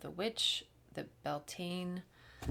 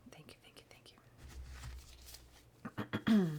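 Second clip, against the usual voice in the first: whispered thanks; much faster than usual. Real, not acted.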